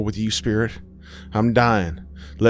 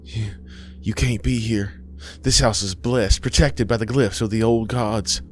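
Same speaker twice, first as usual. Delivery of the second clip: higher, faltering start, then insistent; alarmed protest; 4 semitones higher than usual. Acted. Nauseous Aisha tells spirit it can't be here, alarmed. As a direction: protest in dread, gaining force.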